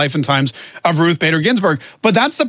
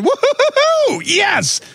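high, whooping mock celebration; hammed-up victory woo-hoo; mock gleeful cheer; 10 semitones higher than usual. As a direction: whoop it up like the best day ever. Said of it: "his first reaction isn't".

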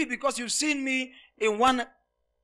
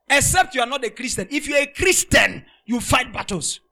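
full-force preaching; 4 semitones higher, 9 dB louder than usual. Acted. Preacher driving the point to his flock, loud and high. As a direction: pull the whole church up with you.

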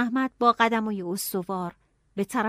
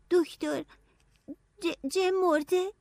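higher, halting, stammering question; worried; 8 semitones higher, slower than usual. Acted. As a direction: stumble over his name in fear. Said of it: "نگران پرسیدم". (با نگرانی و لکنت)

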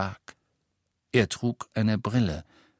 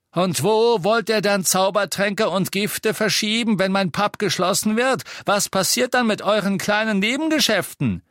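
loud, high taunting questions; angry challenge; 12 semitones higher, 5 dB louder than usual. A narrator's reading. Innkeeper threatening their side business, angry challenge. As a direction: press them hard at full voice.

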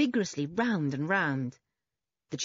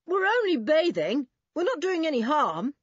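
high, raised, protesting call; 9 semitones higher, 5 dB louder than usual. A narrator's reading. Boy explaining to an angry man, calling out. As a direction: protest it loudly over a distance. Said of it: "called Julian".

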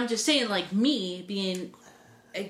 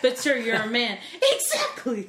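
laughing; 9 semitones higher than usual. Real, not acted. Laughing through joke banter.

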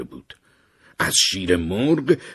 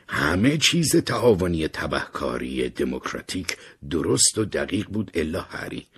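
ordinary delivery, nothing marked

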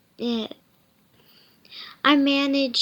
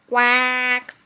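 loud, drawn-out quack; duck quack; 7 dB louder than usual. A narrator's reading. Reader voices the duck's quack.

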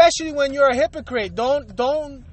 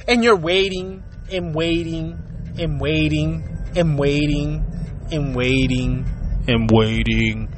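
slowing record; 11 semitones lower, slower than usual. Pretend. Joking about waiting for a reply, voice sinking like a slowing record. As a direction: voice sinks lower and slower like a dying tape. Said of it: "who remember that one?".

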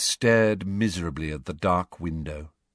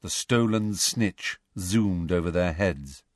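ordinary delivery

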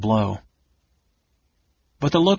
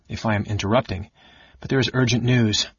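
ordinary delivery

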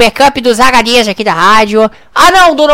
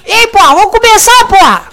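mock thug demand; 8 semitones higher than usual. [name] barks at the crew to start the show. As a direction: bark at them to start.

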